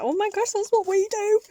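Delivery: high, loud excited squeal. Retold or imitated. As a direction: squeal it with delight. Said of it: "it's like".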